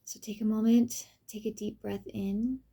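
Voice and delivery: soft, unhurried meditation voice with long pauses; guided meditation. Acted. Reading a pathworking exercise to the listener, guided meditation. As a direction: gently lead the listener through the enchanted forest.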